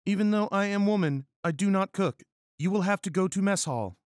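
high, loud, hurried female character voice; regretful Miranda. Acted. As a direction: refuse in a high-pitched put-on voice, mock regret. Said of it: "said Miranda with regretfulness".

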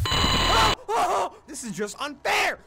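high, loud panicked yell; frightened shout. Real, not acted. Gamer caught by a jump scare, yelling in panic. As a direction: cry out at the unfairness of the scare.

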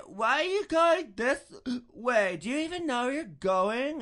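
put-on high, loud drunken snapping at the driver; drunk mouthing off. Drunk passenger yelling at her boyfriend and driver, high and loud. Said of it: "she gets all smart with him".

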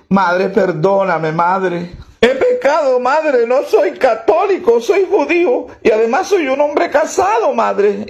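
mock crying, high and wailing; tearful confession. Guilty taxi driver confesses to the nun, mock tearful. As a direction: beg forgiveness in a hammed-up sob. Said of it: "empieza a llorar".

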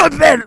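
loud staged cough then plea; fake cough. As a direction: cough hard, then plead loudly. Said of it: "break out into a coughing fit".

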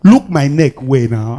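normal talking voice

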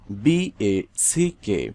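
slow letter-by-letter spelling. Shown as a demonstration. Spelling the word out for learners. (ধীরে অক্ষর ধরে বানান)

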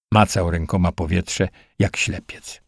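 ordinary delivery, nothing marked

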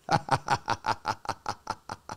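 low-volume villainous chuckle; gloating staccato evil laugh; sinister demon laugh. Acted. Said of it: "it's sarcastic and it's sinister".

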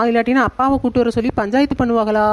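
normal talking voice

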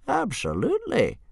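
put-on high old man voice; old Wrinkly. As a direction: cheerfully agree like an old man.

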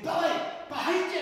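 high, loud call from a distance; big ringing shout. A narrator's reading. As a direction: shout the warning, high and urgent. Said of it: "Then the voice came again".

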